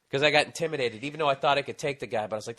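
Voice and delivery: ordinary delivery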